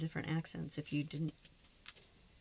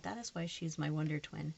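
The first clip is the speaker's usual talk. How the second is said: ordinary talk, nothing marked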